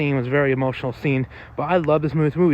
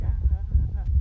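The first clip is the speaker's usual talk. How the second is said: mock yelp; 7 semitones higher than usual. Retelling the pillows scene.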